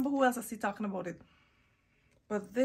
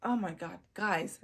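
usual speaking voice

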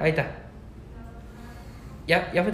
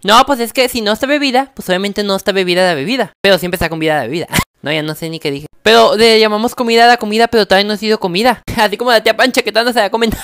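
loud tongue twister; 10 dB louder than usual. Real, not acted.

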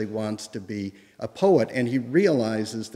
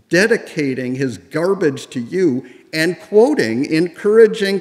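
louder and higher, hammed-up outrage building; mock-furious letter reading; 4 semitones higher, 6 dB louder than usual. Lecturer reading Twain's furious letter to Howells, loud and higher. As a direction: rant it out like Twain in a fury, loud and high.

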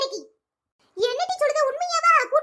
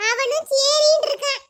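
usual speaking voice

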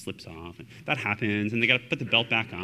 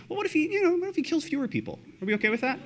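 much higher, mock-naive question; mock absurd question; faster than usual. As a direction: play it as a silly, clueless suggestion. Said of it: "you can't say".

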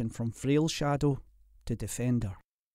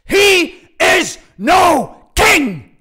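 very slow, high bellow; thunderous, furious denial; enraged roar; 17 dB louder, much slower than usual. A narrator's reading. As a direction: shout each word separately at full force. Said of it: "threw his head back and raged".

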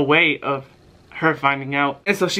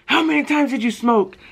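interrogating mom; 9 semitones higher than usual. Retold or imitated.

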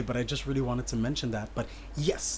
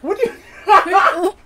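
laughing; 11 dB louder, much slower than usual. Real, not acted. Host laughing at a guest's theory, laughing loudly. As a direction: question dissolving into a high, loud laugh.

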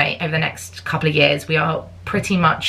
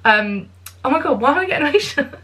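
choked-up excitement; 7 semitones higher, faster than usual. Real, not acted. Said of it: "I can feel myself getting emotional".

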